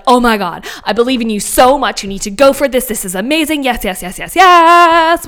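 gushing encouragement building to a long high yes; excited cheering. Acted. We cheer on our best friends, gushing with excitement.

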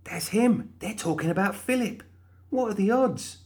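put-on high, bright young woman's voice; excited high-pitched heroine; Molly's voice. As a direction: voice her high and eager.

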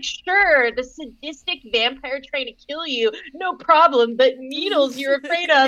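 lightly voiced sarcastic boyfriend; mocking Edward. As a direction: send up the vampire's sarcasm. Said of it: "he's like, he's like".

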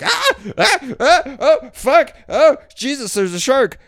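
hammed-up frantic yelps of a terrified swimmer; monkey-brain panic. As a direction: shriek like a primal ape at a shark. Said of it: "monkey mode goes like".

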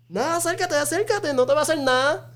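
high, teasing Spanish coaxing; egging on. Retold or imitated. Family urging the kid toward the scare actor, high teasing coax. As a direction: lure the kid closer in a playful high voice. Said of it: "everybody in his family is like".

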